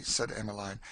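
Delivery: usual speaking voice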